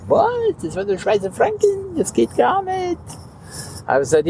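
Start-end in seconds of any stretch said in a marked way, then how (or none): 0.1-3.0 high, panicky mock employee voice; much higher, hammed-up panic; panicked cashier. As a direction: squeak it out like a flustered clerk. Employee panicking over Swiss francs.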